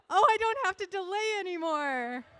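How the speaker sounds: high, loud outburst to the room; loud relief. Real, not acted. MC done stalling for the judges, loud relief.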